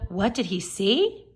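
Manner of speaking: ordinary delivery, nothing marked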